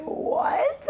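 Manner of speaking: very high-pitched squeal of disbelief; hammed-up, stunned "what?"; shocked. Wrestler retelling who texted her phone, shocked.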